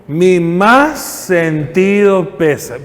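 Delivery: slow, drawn-out stock funeral phrase; mock condolence. Preacher mocking the standard funeral phrase, slow and drawn out. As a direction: drag out the empty condolence formula. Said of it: "la frase más repetida en un funeral".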